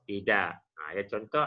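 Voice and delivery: normal talking voice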